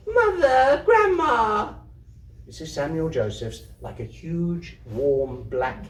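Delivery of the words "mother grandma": put-on high, loud child's wail; a child's sobbing wail, much higher. A narrator's reading. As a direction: cry out for mother and grandma in tears. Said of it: "set up a wail".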